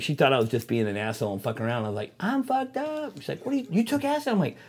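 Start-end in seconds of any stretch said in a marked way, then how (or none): 2.2-3.2 high, softer plaintive voice; mock helpless whine. Retold helpless plea to girlfriend. 3.5-4.3 high-pitched mock disbelief; baffled girlfriend.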